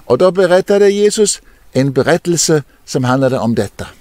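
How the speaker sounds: normal talking voice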